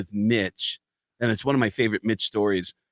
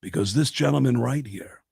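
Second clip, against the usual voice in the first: lightly voiced Gene working the room; [name] voice. Imitating Gene addressing strippers.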